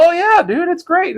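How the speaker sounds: very high, starstruck yes; thrilled new guy answering his hero; eager reply. Retold or imitated. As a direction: squeak it out like an overexcited new hire. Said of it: "I go".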